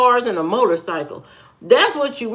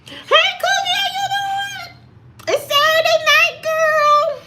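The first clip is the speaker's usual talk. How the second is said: falsetto voice. Shown as a demonstration. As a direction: squeal it up high like a showy diva. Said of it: "you'll never hear a voice like this".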